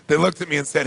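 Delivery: ordinary delivery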